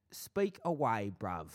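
lightly hammed London geezer; welcoming listeners in put-on Cockney; mock Cockney catchphrase. Repeats catchphrase in accent.

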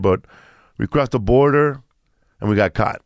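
usual speaking voice

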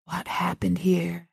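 slow, breathy stunned whisper; hushed horrified whisper, slow. A narrator's reading. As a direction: whisper it in shock. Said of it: "Rollins whispered again, his voice still stunned".

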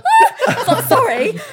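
high, loud, giggly apology; embarrassed laugh. Retold or imitated. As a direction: laugh off the mistake.

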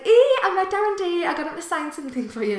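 high, loud, gushing phone-call re-enactment; excited news. Retold or imitated. Daughter ringing her mum about meeting a celebrity, excitedly. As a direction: burst out with the news, then ease slightly.

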